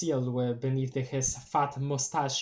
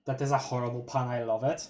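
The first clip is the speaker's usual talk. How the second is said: ordinary talk, nothing marked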